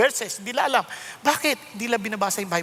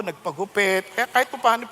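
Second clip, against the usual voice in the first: usual speaking voice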